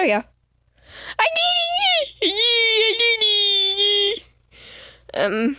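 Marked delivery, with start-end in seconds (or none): 1.2-2.3 high, excited victory shout; squealing I did it; triumphant cheer. Real, not acted. Triumphant cheer after tricky jump. 2.3-4.2 drawn-out cheer. Real, not acted.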